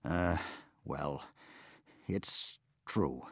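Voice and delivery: quiet sheepish stammer. Acted. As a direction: falter sheepishly. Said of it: "he stammered".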